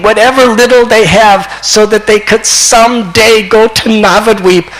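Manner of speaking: high, loud, impassioned acted plea; fervent preaching. Preacher voicing East Bengal's people addressing Nimai, fervent preaching. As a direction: cry it out on behalf of a whole crowd.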